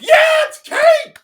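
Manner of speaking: loud, slow, high crowd cheer; cheering shout. Retold or imitated. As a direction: scream it like a thrilled audience. Audience cheering for Kate.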